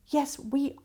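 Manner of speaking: ordinary talk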